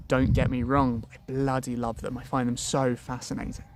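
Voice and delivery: usual speaking voice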